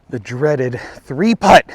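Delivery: "The dreaded three putt" drawn out slowly with a falling close; slow mock-dramatic lament. Golfer to viewers after the three-putt, slow mock-ominous. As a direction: savour each word like a gloomy announcer.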